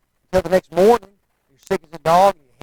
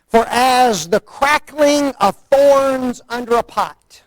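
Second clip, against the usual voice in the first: slow scripture reading; 6 semitones higher, slower than usual. Real, not acted. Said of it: "Can you hear 'em?".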